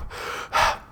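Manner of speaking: winded exhale; soft panting breath. A narrator's reading. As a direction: puff to catch your breath. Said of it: "More breathing".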